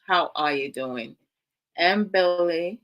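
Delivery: normal talking voice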